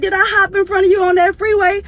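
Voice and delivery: high, loud teasing question; imitating the elderly lady. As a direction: tease like a cheeky old lady. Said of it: "she was like".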